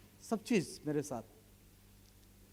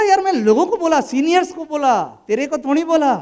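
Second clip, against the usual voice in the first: higher, louder, exasperated comeback; exasperated retort; 5 semitones higher, 5 dB louder than usual. Retold or imitated. Manish retorting to his friend, higher and louder. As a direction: snap back in disbelief.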